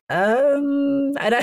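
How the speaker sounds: long, high drawn-out um; hesitant consideration. Retold or imitated. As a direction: stretch out a high, tempted hesitation. Hesitates at the whipping offer.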